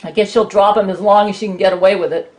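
usual speaking voice

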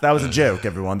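usual speaking voice

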